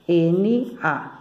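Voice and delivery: ordinary talk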